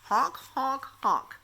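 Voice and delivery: car honk. Acted. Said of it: "I can make a noise like a car".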